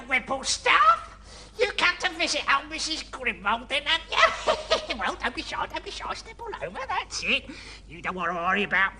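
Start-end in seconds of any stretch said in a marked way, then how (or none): 4.2-4.9 witch cackle. Acted. Cackles at visitor.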